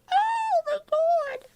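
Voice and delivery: very high, drawn-out fangirl squeal; excited squeal. Retold or imitated. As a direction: squeal it slowly, re-enacting your thrill. Retells excited reaction to friend's news.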